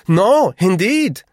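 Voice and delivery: high, loud, slow wife's voice; Frau Vogel. A narrator's reading. Frau Vogel backs her husband, high and emphatic. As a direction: chime in high and drawn-out.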